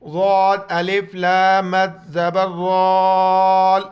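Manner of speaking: loud, drawn-out teacher spelling; spelling drill. Shown as a demonstration. Teacher to students, loud spelling drill. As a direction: call each letter out to the class. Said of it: "دیکھیں ان کو".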